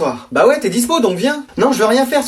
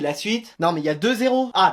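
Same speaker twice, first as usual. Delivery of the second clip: usual speaking voice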